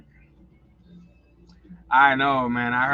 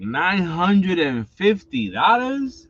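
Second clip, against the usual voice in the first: shocked disbelief; 4 semitones higher, much slower than usual. Retold or imitated. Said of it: "And I was like".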